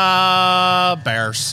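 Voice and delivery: Chicago twang. Pretend. Asked by the host for a Midwestern twang, Chicago accent. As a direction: say it like a Chicago sports fan. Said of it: "Can you do a little Midwestern twang?".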